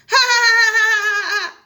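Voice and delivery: loud, high burst of laughter; loud mocking laugh. Player laughing at the stinky feet remark, loud.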